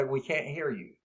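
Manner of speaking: normal talking voice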